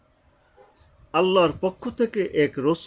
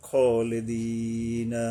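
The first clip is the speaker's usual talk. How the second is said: খলিদিনা with the vowels held very long; drawn-out recitation; 4 semitones lower, much slower than usual. Shown as a demonstration. Teacher shows the one-alif stretch, very slow. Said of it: "আবার একালিপ".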